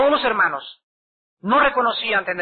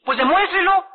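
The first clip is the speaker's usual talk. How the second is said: higher, louder, forceful challenge; challenging retort; 10 semitones higher, 4 dB louder than usual. Retold or imitated. Preacher daring the doubting woman to prove her faith, high and loud. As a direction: throw the dare back at her.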